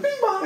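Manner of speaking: high two-note chime; mock door chime. Imitating the subway stop chime, high.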